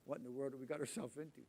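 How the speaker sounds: soft, fast stunned mumble; overwhelmed muttering. Retold or imitated. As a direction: mutter it under your breath in disbelief. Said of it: "here's what you say".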